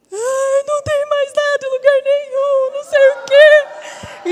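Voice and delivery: high, loud, wailing through tears; crying out the news. Retold or imitated. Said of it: "E chorando, chorando". (chorando alto de alívio, voz bem aguda e forte)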